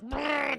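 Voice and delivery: high rolled machine-gun trill; imitated gunfire. He starts blasting the guards, imitated gunfire. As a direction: trill it like rapid fire. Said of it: "he just starts".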